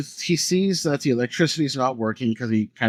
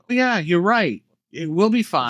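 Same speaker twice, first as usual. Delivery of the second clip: higher-pitched mock going-along; gullible agreement; 6 semitones higher than usual. Host mocking the dumbasses who agree, gullible and high-pitched. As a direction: play the dumbass who readily gives in. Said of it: "all the dumbasses that".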